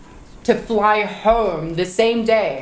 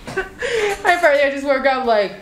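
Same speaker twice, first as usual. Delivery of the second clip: laughing while retelling; 5 semitones higher than usual. Real, not acted. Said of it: "laughing retelling drugged jealousy".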